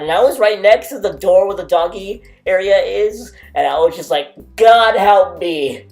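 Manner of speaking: loud over barking. Real, not acted. Telling about the dogs barking insanely loud, loud voice. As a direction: talk loudly over the noise.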